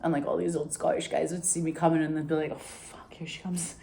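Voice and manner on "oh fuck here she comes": under-the-breath whisper of dread; imitating grumbling old men. Old Scottish no voters seeing her coming, whispered groan. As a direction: whisper it like a resigned old guy.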